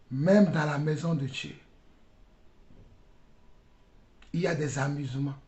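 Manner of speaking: low, soft preacher's voice; quiet, grave repetition; hushed rebuke. Real, not acted. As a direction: drop right down after the shouting.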